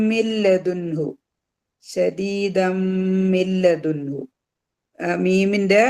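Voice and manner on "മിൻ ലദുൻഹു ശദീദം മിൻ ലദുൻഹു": ശദീദം hummed long into മിൻ, ലദുൻഹു recited slowly; nasal merging. Shown as a demonstration. Teacher recites the verse as demo, ശദീദം held on a nasal 'm'. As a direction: hum the m where ശദീദം meets മിൻ. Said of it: "മണിച്ചുകൊണ്ട് ലയിപ്പിക്കുക".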